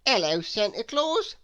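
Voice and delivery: lightly voiced chatty snowman; a friendly new creature greeting. A narrator's reading. Mister Snow greeting Santa Claus: lightly voiced chatty snowman. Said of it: "said Mister Snow".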